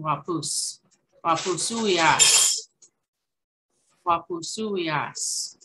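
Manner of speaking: wapusuyas said slowly, syllable by syllable; slow teaching demo. Shown as a demonstration. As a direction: sound out wapusuyas slowly for learners. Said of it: "teaching Cree word slowly".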